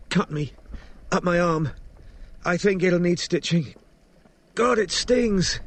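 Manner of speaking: high woman's voice in pain. A narrator's reading. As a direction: report the injury, wincing at the sting. Injured woman describes her wound.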